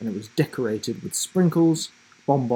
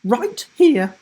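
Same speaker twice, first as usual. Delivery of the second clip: high, loud and firm; firm grandma; 13 semitones higher, 9 dB louder than usual. Acted. Grandma cuts in on the teacher, firm and loud. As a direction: cut in firmly as a cheeky grandma. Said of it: "said Grandma Rivers firmly".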